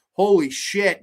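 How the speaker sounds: usual speaking voice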